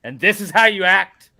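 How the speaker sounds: loud playful telling-off; mock scolding. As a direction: tease him like a disappointed parent. Mock scolding co-host on stream.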